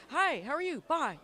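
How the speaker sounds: high, quick in-and-out greeting; breezy passing hello. Retold or imitated. Convention friend rushing past with a hurried hello. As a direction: toss it off like passing someone in a crowded hall.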